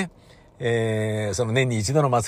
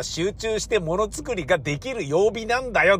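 ordinary talk

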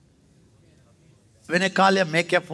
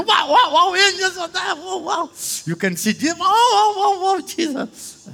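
high squealing wow, loud and amazed; childlike wonder; 4 dB louder than usual. Retold or imitated. New believer gasping at angels, high excited wows. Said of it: "When we, somebody says".

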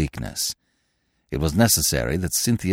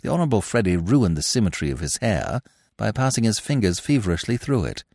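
plain reading voice

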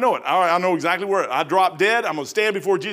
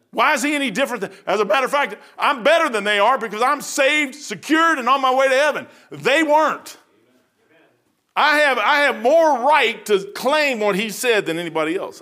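higher, insistent pulpit delivery; impassioned preaching; 5 semitones higher, 4 dB louder than usual. Acted. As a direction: preach it with rising force. Preaches at full force.

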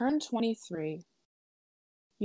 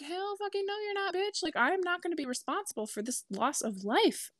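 mock outrage; 11 semitones higher than usual. Telling friends how she scolded her dog, high mock outrage. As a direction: squeak it out in mock fury.

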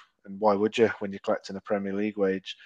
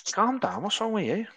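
high mock wife voice, baffled; wife reacting to his outburst, high and mocking; imitating the wife; 12 semitones higher than usual. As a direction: squeak the wife's calm down much higher. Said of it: "she's like".

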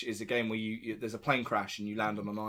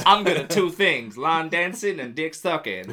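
Southern Belle voice; 3 semitones higher, 7 dB louder than usual. Pretend. Continuing the Paulette bit.

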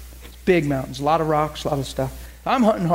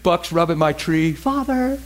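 laughing imitation; slower than usual. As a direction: giggle through her words as you imitate her. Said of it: "she'll be like".

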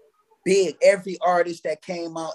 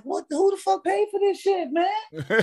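much higher, squeaky disbelief with a laugh; shocked. Retold or imitated. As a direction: play a stunned newcomer, laughing. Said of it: "I'm like".

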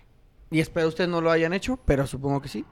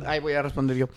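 ordinary talk

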